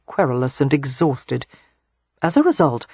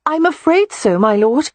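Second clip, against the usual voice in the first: put-on higher, apologetic young woman's voice; 11 semitones higher, 5 dB louder than usual. A narrator's reading. Beatrix apologises to the Earl, higher and sorry. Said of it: "Beatrix said apologetically".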